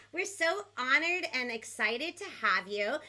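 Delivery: ordinary talk, nothing marked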